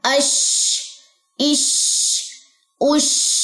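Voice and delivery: अश इश उश with hissing, spreading air; spread shin. Shown as a demonstration. Teacher demonstrating tafashi on shin, hissed air. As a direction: let the air spread on the शीन. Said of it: "इसमें तफशी होती है, आवाज फैलती है".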